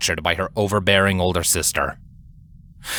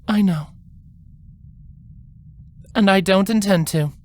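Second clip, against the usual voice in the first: gentle higher voice of a caring elder sister; soft, kind older sister; 11 semitones higher than usual. A narrator's reading. Older sister softly reassures Twilight before leaving, kind and gentle. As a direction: speak softly, kindly, to reassure her. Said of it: "Celestia spoke softly".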